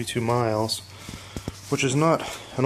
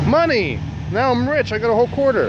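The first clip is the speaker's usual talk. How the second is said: bright mock delight over a quarter; playful excitement; 10 semitones higher, 3 dB louder than usual. Real, not acted. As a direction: joke about being rich. Finds a quarter in the bay.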